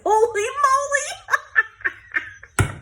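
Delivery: high, loud, drawn-out exclamation with laughter; real startled laughing cry; laughing dismay. Real, not acted. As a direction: burst out laughing at your own spill. Just dumped the chipboard.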